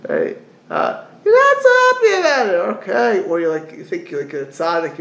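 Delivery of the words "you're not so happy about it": a much higher, put-on teasing voice; mocking sing-song. Preacher teasing the reluctant lender in a high, louder sing-song. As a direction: tease the grudging giver, high and louder.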